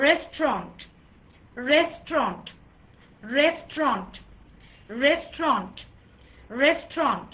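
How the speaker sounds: রেস্টুরেন্ট squeezed to two syllables, "RES-tront"; silent U. Shown as a demonstration. Teacher leading a five-times repeat drill, silent-U pronunciation. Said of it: "just make the ইউ letter silent".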